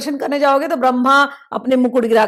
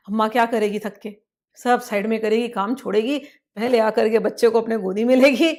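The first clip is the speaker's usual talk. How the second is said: ordinary delivery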